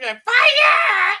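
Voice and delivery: very high, loud mock-girly shriek; girlish scream. Mocking the lightsaber scene, a high girly scream. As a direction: shriek it like a girl, hammed-up. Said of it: "Like a girl".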